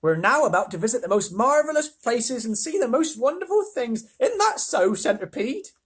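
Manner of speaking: high, bright-eyed lady voice; excited ladybird. Acted. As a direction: promise wonders eagerly.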